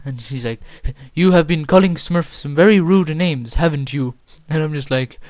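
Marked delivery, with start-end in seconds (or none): 1.1-4.1 louder, higher accusing teacher voice; imitated accusation. Staff member confronts a student over rude names, accusing. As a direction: put the accusation firmly, mimicking a teacher.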